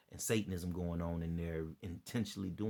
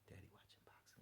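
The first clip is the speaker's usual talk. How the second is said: hushed whisper; kids whispering; 26 dB quieter, faster than usual. Retold or imitated. Imitating his kids sneaking past him, soft whisper. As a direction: whisper like a child creeping past. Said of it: "like they be whispering".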